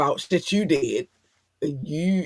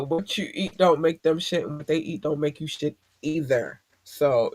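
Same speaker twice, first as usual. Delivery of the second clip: usual speaking voice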